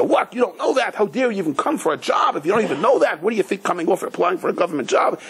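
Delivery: high, indignant grilling of the applicant; scornful interviewer. Retold or imitated. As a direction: pour scorn on someone who doesn't know the basics. Interviewer berating job applicant.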